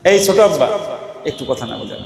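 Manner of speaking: soft fatherly whisper-close plea; gentle request. Shown as a demonstration. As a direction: ask the little one to hush like a loving father. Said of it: "এই কথাটা কিন্তু ধমক দিয়ে বলা যায়".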